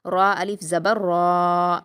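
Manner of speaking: را الف زبر را very slow with a full, heavy را. Shown as a demonstration. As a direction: read را full and long. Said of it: "ہم اس کو پر پڑھیں گے". (را کو پُر اور کھینچ کر)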